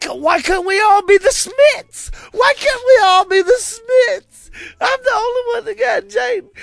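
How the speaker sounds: high, loud mock-outraged wailing; retold pissed-off Sal. Friend imitates Sal furious over his tattoo, pissed-off yelling. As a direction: yell the complaint in an aggrieved high voice. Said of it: "Sal was pissed. He was like".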